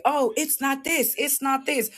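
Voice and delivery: a light parody of doubters; mock naysayer voice. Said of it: "People that say".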